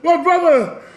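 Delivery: put-on high, loud comic African accent; Trading Places parody. Co-hosts riffing on Trading Places, shouted mock accent. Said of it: "I'm just thinking of Trading Places".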